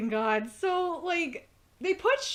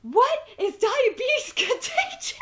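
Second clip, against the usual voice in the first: high laughing quote; 11 semitones higher, 4 dB louder than usual. Retold or imitated. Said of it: "Archer says in response".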